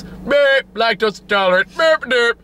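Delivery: high robotic mock doctor voice; dumb movie doctor. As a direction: play a clueless movie doctor beeping out a diagnosis. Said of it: "that's how I imagine all the dumb doctors in movie talk".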